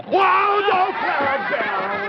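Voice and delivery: high laughing yelp; startled yelp. Real, not acted. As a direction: yelp in surprise while laughing. Clown squirts host.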